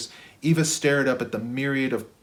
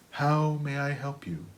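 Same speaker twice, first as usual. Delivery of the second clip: softer, relaxed system voice; gentle automated helper; relaxed intercom; 4 semitones higher, 3 dB quieter than usual. Acted. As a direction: offer help calmly, like a smart home. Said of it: "the intercom spoke in a relaxed tone".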